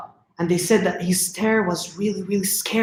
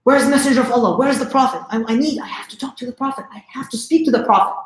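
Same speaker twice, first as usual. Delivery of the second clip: higher-pitched, mildly frantic imitation of someone shaking; retold urgent plea, pitched up and hurried; shaking urgency; 5 semitones higher, faster than usual. Shaking newcomer at the masjid begs for the Prophet, mock-frantic. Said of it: "he's, like, shaking".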